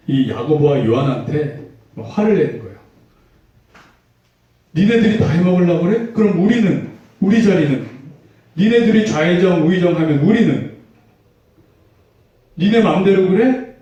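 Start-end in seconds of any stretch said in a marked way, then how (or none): 4.8-13.8 higher, louder, quick indignant griping; a petty quarrel over seats; squabbling disciple. Retold or imitated. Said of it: "막 이러면서 서로 싸운 거예요".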